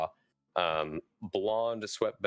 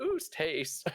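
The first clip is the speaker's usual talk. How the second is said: higher goose honk; 7 semitones higher than usual. Acted. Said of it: "My goose voice is back".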